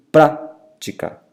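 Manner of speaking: prática slowly split, PRÁ punched; stress on PRÁ. Shown as a demonstration. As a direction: hit PRÁ in prática, pause before the rest. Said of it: "antepenúltima sílaba mais forte".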